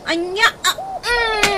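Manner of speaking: loud laugh through a yelp. Acted. Pinched while teasing, laughing yelp. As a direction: laugh and squeal at once.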